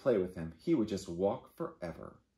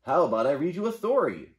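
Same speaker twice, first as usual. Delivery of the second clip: beaver voice; 5 semitones higher, 7 dB louder than usual. Acted. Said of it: "said Beaver".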